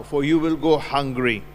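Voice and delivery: ordinary talk, nothing marked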